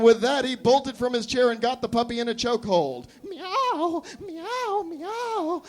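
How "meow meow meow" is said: high, strained cat meows; screaming kitty talk. Acted. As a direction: scream like a cat through the lodged head. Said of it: "screamed the lady".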